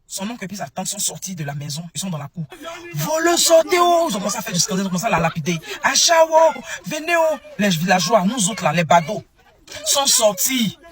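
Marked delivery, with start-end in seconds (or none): 2.9-4.1 high, loud cry of "thief, get out"; retold shout. Aunt and uncle yelling at the intruder, high and loud. Relatives chase supposed thief. 5.8-7.4 high, loud cry of "thief, get out"; retold shout. Aunt and uncle yelling at the intruder, high and loud. Villagers called to help.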